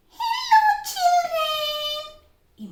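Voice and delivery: very high squeaky parrot voice, loud. Shown as a demonstration. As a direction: chirp it like a puppet parrot. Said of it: "you can say".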